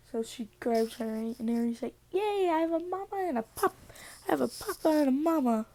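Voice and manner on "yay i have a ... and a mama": put-on high, squealing kid delight, faster at the end; excited little kid. Eri cheering about her new mama and papa, high squealing delight. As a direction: squeal it like a thrilled small child.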